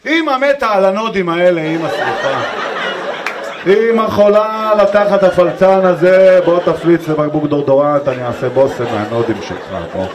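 hammed-up besotted mother; wife gushing over baby. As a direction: coo at the baby at full volume. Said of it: "לא ראיתם טירוף כזה בחיים שלכם".

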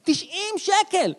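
can't believe the price, drag it out; slow shocked repeat of the price; outraged disbelief. Acted.

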